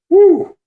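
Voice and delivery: high, loud "woo"; relieved whoop. Retold or imitated. Relief that the pin holds.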